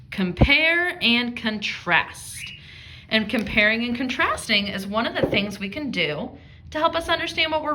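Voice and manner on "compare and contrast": compare and contrast drawn out slowly, each word clear; slow term for the class. Shown as a demonstration. Teacher naming the new term for her class, slow and clear. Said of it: "We have a word for that".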